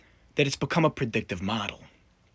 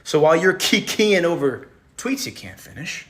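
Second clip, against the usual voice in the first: higher, louder mock outrage; mock scolding; 5 semitones higher, 7 dB louder than usual. Lecturer scolding Ben for laughing at tweets, loudly. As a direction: berate him in mock fury.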